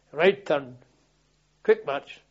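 loud parade-ground order; drill command. Retold or imitated. Sergeant ordering the squad to move off, loud drill command.

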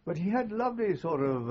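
usual speaking voice

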